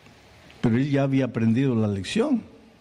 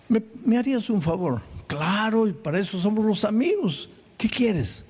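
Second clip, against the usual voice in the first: higher, eager friend asking a favour; raised, friendly storyteller's voice; 6 semitones higher than usual. Acted. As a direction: chirp it up like a good buddy. Voiced dialogue between friends.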